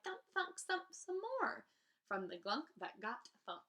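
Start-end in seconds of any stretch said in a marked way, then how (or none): 0.0-1.7 high, slow, strained plea; straining cry. A narrator's reading. She cries out with all her might, high and slow. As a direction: squeeze out every drop of effort. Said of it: "she cried".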